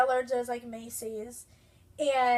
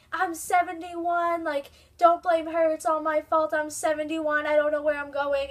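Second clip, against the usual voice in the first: imitated old driver yelling, higher and louder; 5 semitones higher, 6 dB louder than usual. Old driver yells into the phone at the store manager, loud and high. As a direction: shout it like the flustered seventy-one-year-old driver. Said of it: "she starts yelling to the person".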